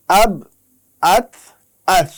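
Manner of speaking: আব, আত, আস said with stopped final consonants; sukun sounds drilled. Shown as a demonstration. Teacher drilling students on sukun endings. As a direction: clip each syllable short at the closing letter. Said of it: "আমরা এখন আপনাদেরকে দেখাবো".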